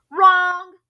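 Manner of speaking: a loud, high, sharp gorilla bark. Acted. Gorilla rejects Kate's answer.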